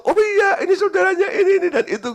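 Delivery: very high, loud, amused recognition; acted welcome. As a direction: mimic an eager host, laughing through it. Acting out family's welcome, amused.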